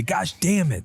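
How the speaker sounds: lightly voiced copycat kid; mimicking child. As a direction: imitate a kid copying a parent's curse. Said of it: "And then Rue just mimics me".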